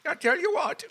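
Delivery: very high, badly faked Irish lilt; squeaky botched Irish accent; attempted Irish accent. Shown as a demonstration. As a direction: attempt a thick Irish brogue that drifts toward Jamaican. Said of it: "with a thick Irish accent".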